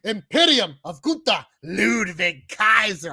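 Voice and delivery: drawn-out ring-announcer introduction; ring announcer Samantha. Retold or imitated. Co-host copying how the announcer says it, drawn-out announcing. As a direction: stretch each name like a ring announcer. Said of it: "I love the way Samantha says that".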